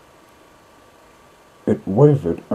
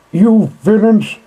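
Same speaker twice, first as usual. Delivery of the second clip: high, loud enraged yell; furious shout; 12 semitones higher, 9 dB louder than usual. Acted. Rat yells at the vanished motorists, furious high shout. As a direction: shake your fists and scream it at the car. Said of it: "he shouted, shaking both fists".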